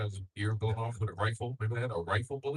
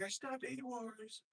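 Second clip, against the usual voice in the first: slow, softer, higher mock Trump; Trump impression; 9 semitones higher, 9 dB quieter, slower than usual. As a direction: drag it out like a boastful Trump. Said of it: "He's like".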